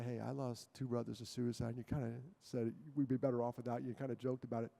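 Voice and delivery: quiet, low caring voice; private gentle talk. Retold or imitated. Speaker to kid pulled aside privately, quiet and caring. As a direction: pull them aside and speak softly. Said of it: "I pull 'em aside privately".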